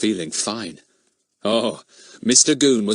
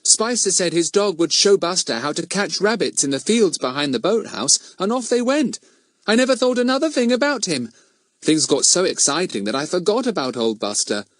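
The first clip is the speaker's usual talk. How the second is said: high Larry figure, explaining eagerly; eager, higher-pitched recollection; excited schoolboy; 10 semitones higher than usual. Acted. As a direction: recount it in a boy's voice.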